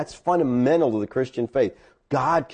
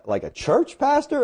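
slow question jumping high in pitch; incredulous question; 11 semitones higher than usual. Retold or imitated. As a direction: imitate a buddy who can't believe it. Old buddy's surprised follow-up question.

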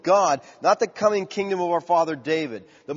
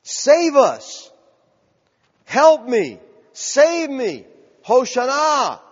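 raised, high calls for rescue; desperate cry; 7 semitones higher, 6 dB louder, much slower than usual. Acted. Preacher voicing the people's cry for help, calling out. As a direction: cry out each plea, falling at the end. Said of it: "That's the cry of the people".

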